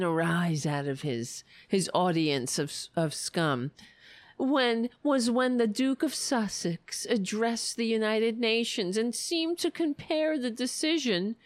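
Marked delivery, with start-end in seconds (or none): none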